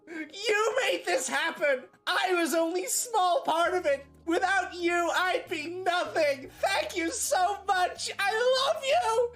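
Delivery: overwhelmed winner crying thanks; tearful award speech. Pretend.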